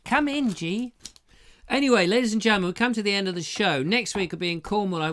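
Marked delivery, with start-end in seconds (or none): 0.0-0.9 high, put-on welcoming voice; mock greeting. Comic greeting a visitor called G, high mock welcome.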